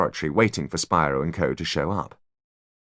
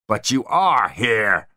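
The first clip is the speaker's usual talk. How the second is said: roaring; 4 semitones higher, 4 dB louder, much slower than usual. A narrator's reading. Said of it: "roared Spyro".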